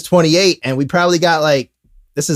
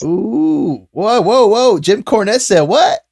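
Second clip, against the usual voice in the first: surprised; 6 semitones higher, slower than usual. Real, not acted. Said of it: "shocked reaction to quote".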